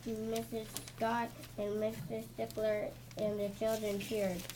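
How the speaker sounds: plain reading voice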